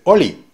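oli said with no H, just the vowel; dropped H. Shown as a demonstration. Teacher demonstrating Jamaican patois, dropping the H. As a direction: say holy without its H, as oli. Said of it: "en lugar de decir holy".

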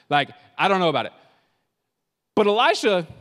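ordinary delivery

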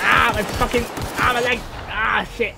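high, loud yelps of pretend agony; mock pain cries. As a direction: cry out as if your game leg got hit. Game character's leg gets hurt.

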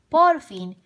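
ordinary delivery

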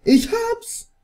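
high triumphant cry; excited shout. Real, not acted. Thinks he hooked it.

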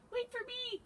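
high, thin cry to be waited for; Mouse. A narrator's reading. Little mouse trails the others in woods, high cry. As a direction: call out in a tiny high voice. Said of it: "cried Mouse".

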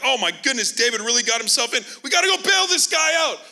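building to a high, loud panicked shout; hammed-up heavenly panic; mock panicked shout. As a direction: heaven scrambling in alarm. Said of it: "they're like".

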